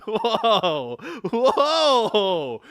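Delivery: loud amused whoa; laughing whoa. Real, not acted. Player amused by the dialogue option, laughing whoa. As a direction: laugh it out in alarm.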